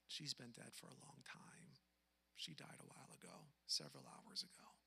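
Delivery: whispered, breathy report of the death; whispered police news; hushed retelling. Retold or imitated. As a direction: drop to a whisper for the bad news.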